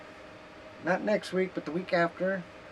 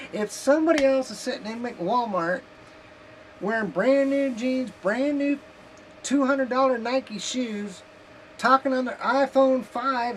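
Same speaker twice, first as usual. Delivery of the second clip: indignant rant; 7 semitones higher, 5 dB louder than usual. Real, not acted. Ranting about others' spending.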